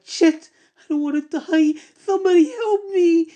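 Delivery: very high, loud crying plea; sobbing terror. Acted. Chased woman sobs for someone to help, very high crying plea. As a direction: cry out for help through tears.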